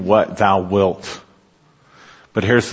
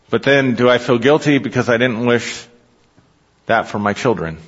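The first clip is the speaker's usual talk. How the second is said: ordinary delivery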